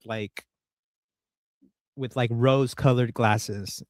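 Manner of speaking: usual speaking voice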